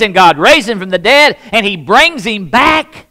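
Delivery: loud, high fervent preaching; preaching at full force. Acted. Pastor tells the congregation of the miracle, fervent preaching. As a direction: drive the story home to the church.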